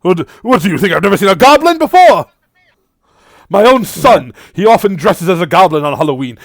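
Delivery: high, loud, fast indignant babble; man's indignant reply. Acted. Man answers the party's question, high and flustered. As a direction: blurt it out, flustered.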